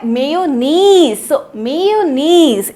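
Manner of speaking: Mayonnaise drawn out syllable by syllable; slow phonetic read. Shown as a demonstration. Teacher reading phonetics for class, slowly. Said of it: "phonetics में भी देख लेते हैं".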